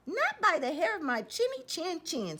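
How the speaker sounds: high, squeaky refusal; squealing little pig. Acted. Pig refusing the wolf through the door, squealing high. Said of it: "squealed the little pig".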